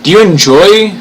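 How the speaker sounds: high, loud, rising ask; eager streamer asking the viewers; excited question. Real, not acted. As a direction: pump up the chat. Hyped question to chat.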